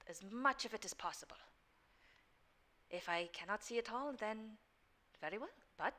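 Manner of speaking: a hushed, coaxing stranger making a request; softly persuade, dropping to a near whisper; lightly voiced stranger. A hint of a mysterious stranger pleading with Johan.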